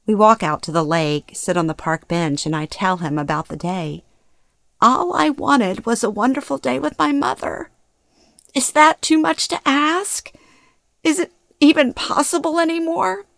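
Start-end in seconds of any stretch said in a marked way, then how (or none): 4.8-13.2 high-pitched, anguished plea; distraught. A narrator's reading. Daughter pouring out the day to Jimmy, distraught. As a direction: plead as if close to sobbing. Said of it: "as my body shakes uncontrollably".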